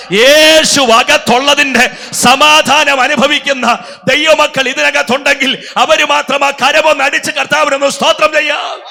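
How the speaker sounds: high, loud, slow preacher's cry building to a shout; preaching at full force. Acted. Pastor stirring the convention crowd about peace, preaching at full force. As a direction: lift the crowd, ringing out each phrase. Prophecy recited, crowd urged to clap.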